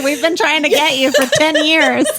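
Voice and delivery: high, loud laughing mock plea; laughing quote. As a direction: laugh through the quoted line. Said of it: "they're like".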